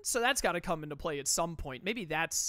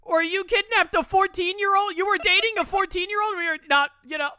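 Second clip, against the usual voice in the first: outraged high yell; 12 semitones higher, 12 dB louder than usual. Real, not acted. Mock lawyer outrage.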